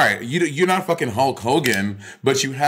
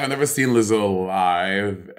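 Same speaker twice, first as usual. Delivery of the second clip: ordinary talk, nothing marked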